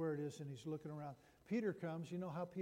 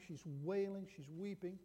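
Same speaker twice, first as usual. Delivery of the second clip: ordinary delivery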